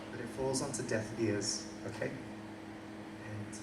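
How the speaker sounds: normal talking voice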